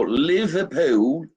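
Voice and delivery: drawn-out mock Liverpool accent; bad English accent. Host hams up fan's Liverpool home town, bad English accent. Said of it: "That's the worst accent".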